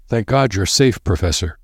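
ordinary delivery

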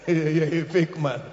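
slow, said through laughter; laughing. Real, not acted. Preacher mocking the fake caller, slow and laughing. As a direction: chuckle it out slowly.

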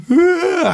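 high, loud sudden gasping cry; overwhelmed cry. Retold or imitated. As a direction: let out a cry as you collapse. Overwhelmed, falls down crying.

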